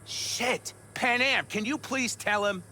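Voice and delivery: high, exasperated cry for backup; frustrated appeal. Acted. Clan member begs Panam to back him against Saul, frustrated.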